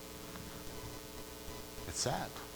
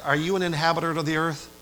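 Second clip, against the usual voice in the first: ordinary talk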